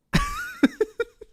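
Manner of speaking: a sudden high burst of disbelieving laughter; incredulous laugh. Real, not acted. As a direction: laugh out at how stupid it is. Streamer reacting to dumb movie take.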